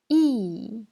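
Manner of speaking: Mandarin fourth tone on "I". Shown as a demonstration. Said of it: "je répète les quatre tons".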